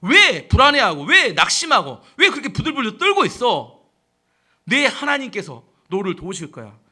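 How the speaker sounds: loud, high scolding exhortation; forceful preacher's reproach; shouted self-rebuke. Acted. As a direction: shout some courage into your own soul. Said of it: "자기 영혼을 향해서 외치는 겁니다".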